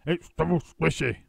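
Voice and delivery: husband complaining while eating; mouth full. A narrator's reading. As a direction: talk through a mouthful. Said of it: "talking with his mouth full".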